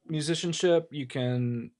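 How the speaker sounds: ordinary delivery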